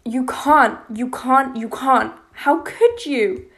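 anguished crying outburst. A narrator's reading. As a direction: plead and accuse through tears. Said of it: "Now I'm crying".

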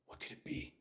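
whispered, mystified question; hushed wonder. Acted. Visitor nears the source of the chanting, hushed wonder.

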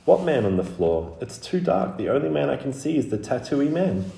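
put-on high child's voice, crossly confused; frightened little girl getting annoyed; irritated, puzzled, scared. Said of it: "Scarlet sounded irritated now, as well as puzzled and scared".

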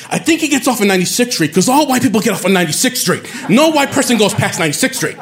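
high, fast mock gossip; gossiping girl. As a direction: rattle it off high like a catty girl guessing. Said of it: "She's saying stuff like".

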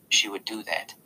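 ordinary delivery

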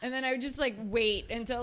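normal talking voice

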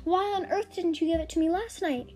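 mildly scolding mum asking why now; exasperated mother. Acted. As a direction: tut at the forgetful daughter.

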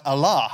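"alloh" said loud and clear, stress on the first syllable, AH-loh; Greek word alla. Shown as a demonstration. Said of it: "it's the word".

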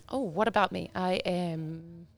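usual speaking voice

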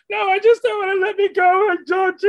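high, loud desperate begging; pleading cheerleader. Acted. Cut cheerleader begging the coach for her spot, high and pleading. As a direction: beg the coach not to be cut. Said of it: "acting the cut cheerleader".

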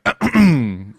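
loud, attention-seeking "ahem"; pointed throat-clear. Acted. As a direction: clear your throat to claim what's yours. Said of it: "Suraqa is like".

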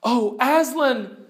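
high, drawn-out despairing cry; acted storybook despair. A narrator's reading. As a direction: plead it slowly in a high voice. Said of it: "both together in despairing voices".

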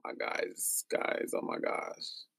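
hushed, slowed whisper. Real, not acted. Host whispers to her listeners, overwhelmed, barely voiced. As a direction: breathe the words with no voice.

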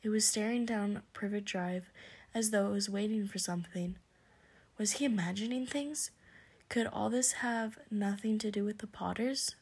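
ordinary delivery, nothing marked

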